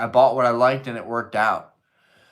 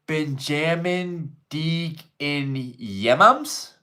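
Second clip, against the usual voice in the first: very slow, higher, rising into a question; puzzled sounding-out; 5 semitones higher, much slower than usual. Real, not acted. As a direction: sound out each word uncertainly. Sounding out a chat message.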